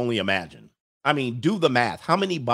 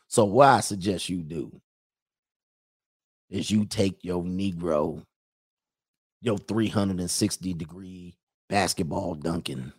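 low-pitched Mississippi lawman making a suggestion; Mississippi accent; 5 semitones lower than usual. Acted. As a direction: lay it out low and deliberate, Mississippi style. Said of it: "do the Mississippi accent".

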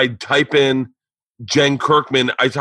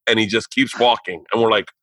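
ordinary talk, nothing marked

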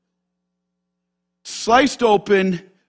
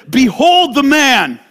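loud, slow proclamation; Pilate's proclamation; 6 semitones higher, 6 dB louder, slower than usual. Acted. Pilate showing Jesus to the crowd, loud and slow. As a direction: present him to the crowd, slowly. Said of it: "Pilate says".

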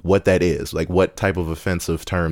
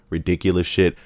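usual speaking voice

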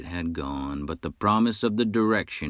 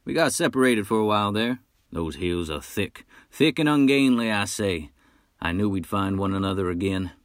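boastful hunter; 3 semitones higher, 3 dB louder than usual. Acted. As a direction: brag like a swaggering frontier hunter. Nash chatters cheerfully.

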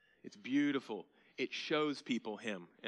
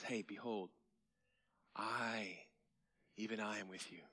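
softer, hushed promise; tender Jesus; 2 semitones lower, 7 dB quieter than usual. Retold or imitated. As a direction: reassure them quietly. Jesus reassuring his followers.

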